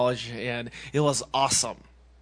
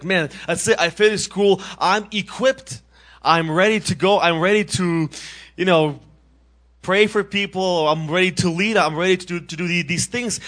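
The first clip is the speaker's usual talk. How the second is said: higher and louder, bursting with readiness; excited; 5 semitones higher, 7 dB louder than usual. Retold or imitated. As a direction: sound ready to take on the world. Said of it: "I was so excited because I'm like".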